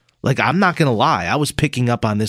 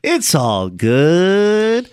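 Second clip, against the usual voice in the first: slowly drawn-out; 4 semitones higher, much slower than usual. Retold or imitated. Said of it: "That's how he said it".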